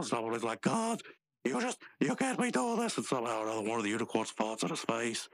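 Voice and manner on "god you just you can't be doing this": high-pitched cartoon pleading; exasperated protest. Rigby trying to stop the unicorns, high exasperated protest. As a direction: protest in a much higher voice.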